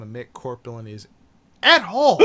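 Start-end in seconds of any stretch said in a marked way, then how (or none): 1.6-2.2 heated yell; loud, high outburst. Real, not acted. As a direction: shout the question, high. Heated rant peak.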